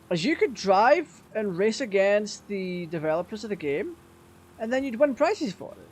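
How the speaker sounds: usual speaking voice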